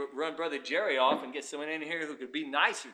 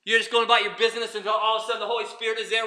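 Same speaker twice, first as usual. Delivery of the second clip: normal talking voice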